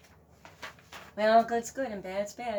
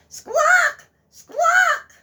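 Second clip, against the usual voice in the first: high shrieking squawks; goose squawk; 17 dB louder than usual. Acted. Goose squawking to wake the farmer, shrieking.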